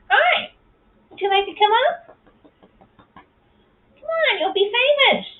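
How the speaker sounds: very high cooing voice calling a pet; high sweet coaxing. Real, not acted. Coaxing the cat to come up, very high sweet voice. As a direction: coax him out, sing-song and high.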